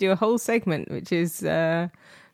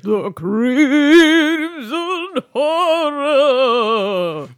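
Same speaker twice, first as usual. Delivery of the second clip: slow, high, wobbling horror title; wobbly voice; 6 semitones higher, 5 dB louder, much slower than usual. Pretend. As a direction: drag the title out in a quavering spooky voice. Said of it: "Yes, it was very good".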